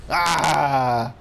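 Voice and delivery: loud, drawn-out "ah"; gamer's yell. Real, not acted. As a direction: shout it out as you lunge for the ball. Yell during play.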